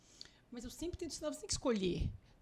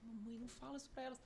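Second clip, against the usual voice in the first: embarrassed daughter; 2 semitones higher, 10 dB quieter than usual. Retold or imitated. Daughter embarrassed in front of friends.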